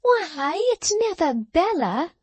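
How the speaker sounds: high, startled cry of surprise; surprised exclaim. A narrator's reading. Lavvy sees her sister at the gate, surprised. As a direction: gasp it out as if you can't believe it. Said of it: "exclaimed Miss Lavvy".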